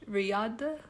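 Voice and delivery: ordinary delivery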